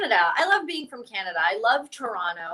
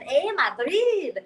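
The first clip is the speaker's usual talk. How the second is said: slow, higher-pitched teasing call; mocking callback; 5 semitones higher, much slower than usual. Comic phoning friends in snowy Madrid, mocking them back. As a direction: stretch it out in a taunting singsong. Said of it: "I called them up last week. I said".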